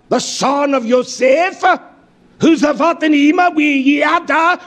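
high, driving pulpit delivery; forceful scripture preaching. Acted. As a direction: declaim the verses, punching each phrase.

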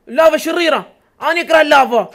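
high, loud outburst against the lava; loud complaint. Real, not acted. Gamer yelling at lava in his cave. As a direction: yell your hatred of the lava.

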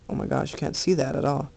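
normal talking voice

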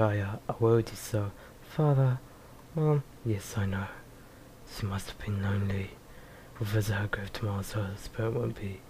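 hushed character voices. Acted. Aya wakes and speaks to father, soft and subdued. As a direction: murmur the lines like a sad reunion. Quiet reading of game dialogue.

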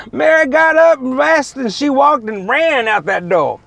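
loud, high, drawn-out storytelling; big, slow comic payoff; excited climax. Real, not acted. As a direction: belt out the getaway, stretching the words.

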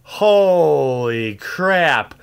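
drawn-out, loud holy crap; slow stunned exclamation at the pull; stunned awe. Real, not acted. Opening a pack, stunned by the card, drawn out.

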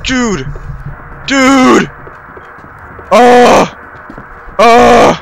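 short bursts of frightened yelling; panicked shouting. Real, not acted. Player scared as the lights go, panicked shouting.

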